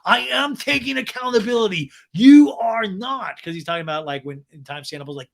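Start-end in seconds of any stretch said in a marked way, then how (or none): 0.1-3.4 loud, high indignant retort; hammed-up defensive shouting; Sandoval. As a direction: imitate an indignant man, punching each phrase. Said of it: "And Sandoval goes".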